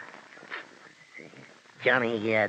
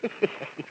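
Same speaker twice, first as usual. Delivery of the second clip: snickering laugh; 9 dB quieter than usual. Acted. Henchman snickering at the pirate's beheading. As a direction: snicker to yourself. Henchman enjoys the guillotine joke.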